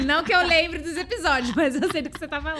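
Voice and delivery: laughing loudly through the words; words tumbling out between laughs. Real, not acted. Laughs while answering host. (rindo alto enquanto fala)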